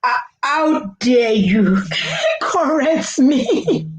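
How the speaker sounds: put-on slow, offended parent scolding the child; a parent outraged at being corrected; lightly acted how-dare-you parent; indignant Nigerian parent. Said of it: "the parents, they don't like that".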